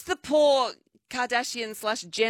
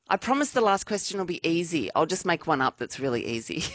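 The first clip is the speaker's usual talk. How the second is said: ordinary talk, nothing marked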